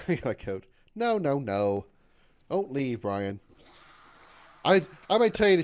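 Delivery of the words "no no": ordinary delivery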